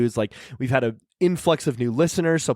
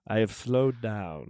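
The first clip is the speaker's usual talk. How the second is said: very slow, lower and softer; 4 semitones lower, 6 dB quieter, much slower than usual. Pretend. Co-host announcing his new speed, drawn-out slow talk. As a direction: drag each word like a record winding down. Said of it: "he's getting slower".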